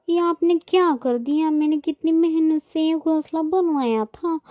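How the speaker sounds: high, loud wail of dismay in words; distraught lament. A narrator's reading. Bird mother finds her nest broken, distraught lament. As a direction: lament a ruined home, pitched well up. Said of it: "ऐसे बोलकर सोनी रोने लगती है".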